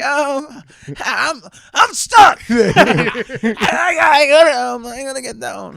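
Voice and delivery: high, loud panicked yell; stuck and yelling. Retold or imitated. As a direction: yell down from the ceiling. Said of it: "He's like".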